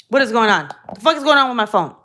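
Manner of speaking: sudden very loud complaint; loud exasperated outburst. Real, not acted. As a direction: blurt it out loudly at the misbehaving phone. Sudden phone trouble interrupts her.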